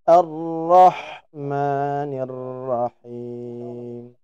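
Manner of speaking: slow recitation; correct H. Shown as a demonstration. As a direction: chant with a light H. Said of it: "Yang insya Allah lebih tepat".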